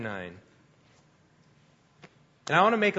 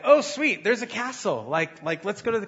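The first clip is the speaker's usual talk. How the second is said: excited; 6 semitones higher than usual. Retold or imitated. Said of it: "and I was like".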